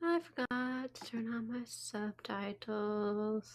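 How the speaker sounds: soft, slow, drawn-out words; rueful quiet aside. Real, not acted. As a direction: stretch it out softly to yourself. Rueful aside while fixing subtitles.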